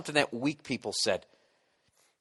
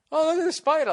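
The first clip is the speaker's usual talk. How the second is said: high, silly mock of himself; a goofy high-pitched "oh look"; 4 dB louder than usual. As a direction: squeak it like an airhead. Mocking his own distraction.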